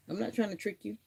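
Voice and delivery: coaxing the dog. Real, not acted. Owner reassuring her dog she is not tricking it. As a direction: reassure a wary pet.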